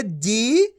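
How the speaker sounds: जी stretched, rising in pitch; long rising ee in जी. Shown as a demonstration. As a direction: draw out जी on an upward glide. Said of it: "खींच के पढ़ेंगे".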